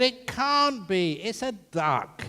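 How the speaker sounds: acted exasperated insistence; exasperated husband. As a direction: argue back loudly and slowly, pitch up. Husband's escalating acted quarrel.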